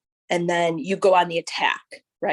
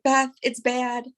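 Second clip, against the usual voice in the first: tearful; 6 semitones higher, slower than usual. Retold or imitated. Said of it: "I was crying so hard".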